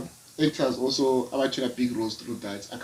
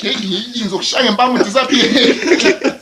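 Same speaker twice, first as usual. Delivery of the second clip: laughing; 9 semitones higher, 8 dB louder, slower than usual. Retold or imitated. Mock threat to kids, laughing.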